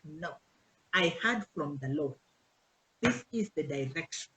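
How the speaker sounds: low, solemn mock-holy claim; mock pious defiance. Member defying the pastor's wife, in mock solemnity. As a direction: play the stubborn member quoting God.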